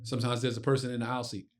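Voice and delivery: usual speaking voice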